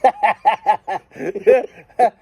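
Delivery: loud laugh rising high; burst of laughter. Real, not acted. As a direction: break into a big laugh. Laughing at choo-choo trains.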